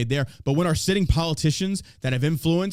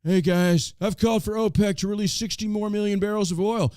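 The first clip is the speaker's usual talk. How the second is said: lightly voiced Joe, a touch higher; Biden impression; 3 semitones higher than usual. Podcast host mocking the president's oil announcement, light impression. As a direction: slip into a mock presidential announcement.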